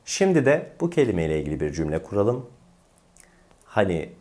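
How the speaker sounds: ordinary talk, nothing marked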